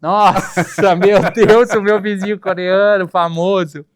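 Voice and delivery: loud, high exclamation breaking into laughter; exasperated laughing outburst. Real, not acted. Guest asked about the infamous Korean neighbour, loud laughing exasperation. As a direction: cry out in amused exasperation at a notorious subject.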